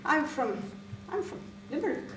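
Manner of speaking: stumble, then pitch it up high; hesitant reply. Acted. Teacher telling stranger where she's from, high hesitant reply. Acted hesitant answer about origin.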